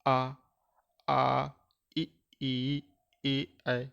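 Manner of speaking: slow classroom vowel drill; vowel demo. Shown as a demonstration. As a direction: sound out आ ए ऐ ओ clearly like a teacher. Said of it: "जैसे हमने देखा".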